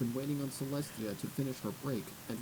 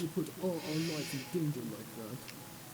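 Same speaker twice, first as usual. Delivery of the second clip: higher, haughty murmuring noble; posh gossip; 5 semitones higher, 13 dB louder than usual. Acted. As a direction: condemn her with upper-class disdain. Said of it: "Their voices weren't as quiet".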